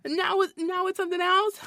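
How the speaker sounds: high-pitched sarcastic jab; mocking high voice. Baby-voice mockery carrying on.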